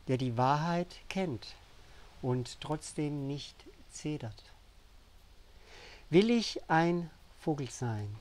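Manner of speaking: measured, emphatic poetry reading with deliberate pauses; poem recitation. Acted. Poet reads an emotional poem to viewers, solemnly reciting. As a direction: lift each questioning refrain, then let it fall.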